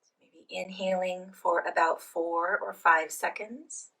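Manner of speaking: soft, unhurried phrases with long pauses; guided meditation. Real, not acted. Leads guided breathing meditation.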